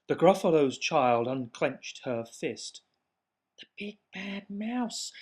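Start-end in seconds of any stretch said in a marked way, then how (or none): 3.6-5.1 hushed, high breath of awe; awed whisper. Acted. Gruffalo's child awed by the mouse's shadow, whispered. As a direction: whisper it as if it might hear you. Awestruck realization.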